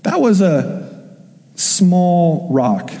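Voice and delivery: slow, with a pause before "small"; deliberate weight on "small"; stress on small. Real, not acted. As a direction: set "small" apart after a beat, drawn out. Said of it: "Emphasis on small".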